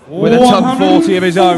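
thrilled commentator; excited commentary. Real, not acted. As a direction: punch it out over the crowd. Commentator reacting to big score.

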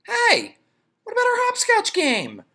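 high calling schoolgirl; high girl calling out. Acted. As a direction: call after him, put out. Jenny calls to Louis.

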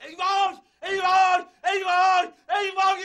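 high, loud alarmed cries; panicked wailing. Acted. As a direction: shriek it over and over in panic. Wife appears, he panics.

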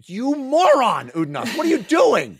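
mock furious shout. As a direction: yell at the fool, hammed-up. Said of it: "Featherwitch is like".

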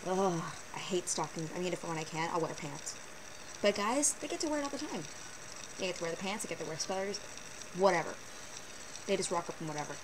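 usual speaking voice

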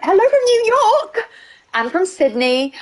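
very high-pitched, put-on call; excited greeting. Real, not acted. Streamer shouting out to a New York viewer, excited.

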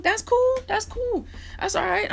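high, airy mock-casual that's cool; sarcastic nonchalance. Real, not acted. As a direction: brush the insult off with sarcasm. Mock shrug at being called bum.

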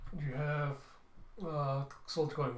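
normal talking voice

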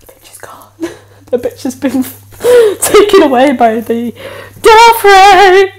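usual speaking voice

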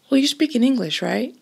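high, puzzled outsider's question; mock puzzled question. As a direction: act baffled that Doric is a separate dialect. You think.

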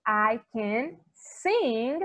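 "I can sing" stretched out very slowly; very slow English model. Shown as a demonstration. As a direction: draw it out for learners. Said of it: "eu digo". (bem devagar: "I can sing")

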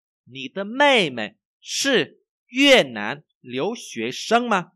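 very slow, loud, word by word; language teacher's model reading. Shown as a demonstration. Teacher reads for learners to repeat, slow model reading. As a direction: exaggerate each tone, high and clearly spaced.

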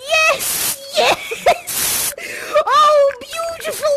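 high, squealing yes, yes, full of delight; ecstatic squeal. Acted. Leader cheering the new sweeper raising a broom, high excited squeal. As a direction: squeal with joy as the broom goes up.

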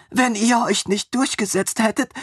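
put-on high mumbled voice of the eager young man; mumbling from the floor. A narrator's reading. Lying on the floor, Armin mumbles to his master in a high voice. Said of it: "nuschelte er vom Boden her".

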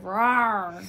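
loud playful shouted roar; lion roar. Pretend. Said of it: "Yeah, go ahead".

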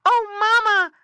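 very high, loud squeal for mother; squeaky piglet cry. A narrator's reading. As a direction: squeal out like a hurt little pig. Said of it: "squealed Squinty".

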